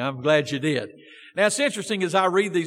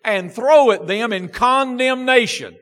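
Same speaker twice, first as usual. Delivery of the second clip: louder fervent preaching; 3 dB louder than usual. Acted. Baptist preacher warning his church against condemning, fervent. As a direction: drive the point home to the pews.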